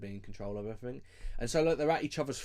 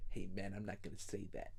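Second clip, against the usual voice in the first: low, softer put-on voice; imitated rapper; 4 semitones lower, 10 dB quieter than usual. Podcaster retelling the rapper's refusal to a co-host, in a low imitation. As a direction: mimic a rapper's understated refusal. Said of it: "like one of the rappers".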